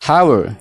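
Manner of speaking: HOW ARE as "hawer", high and falling; linked pronunciation. Shown as a demonstration. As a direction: model How are for learners to repeat. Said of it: "Mee na waliin jedhaa". ("How are" akka "hawer"tti)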